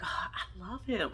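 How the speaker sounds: soft, slow swooning imitation; mock lovestruck. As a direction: sigh it like a smitten friend. Mimics lovestruck friend, softly.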